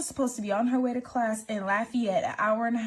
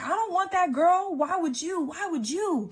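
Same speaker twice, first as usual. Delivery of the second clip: 1 retold angry pleading; 7 semitones higher than usual. Retold quarrel with boyfriend.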